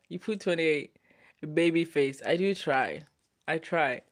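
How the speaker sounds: usual speaking voice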